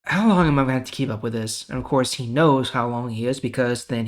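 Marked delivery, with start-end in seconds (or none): 0.0-1.7 frustrated Jesus. Retold or imitated. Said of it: "he probably felt very frustrated".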